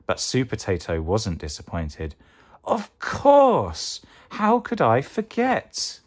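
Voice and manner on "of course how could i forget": very high, excited exclamation; high-pitched dramatic penny-drop; sudden realisation. Acted. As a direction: burst out with a eureka, then stretch it. Sue Potato suddenly realises the answer.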